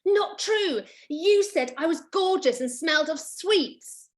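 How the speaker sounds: lightly voiced fairy retort. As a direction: correct him smugly. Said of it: "fairy answers boy".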